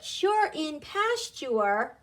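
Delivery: "sure" said slowly as CHER, as in pasture; pronunciation demo. Shown as a demonstration. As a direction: model "sure in pasture" with ch plus er. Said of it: "sounds more like er in nurse".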